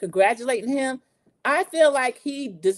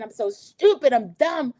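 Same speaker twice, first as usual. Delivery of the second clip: higher, louder imitation of someone upset; mock self-reproach; 4 semitones higher, 4 dB louder than usual. Maura berating herself after betrayal, mock upset. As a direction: lean hard on the self-blame.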